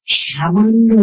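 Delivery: usual speaking voice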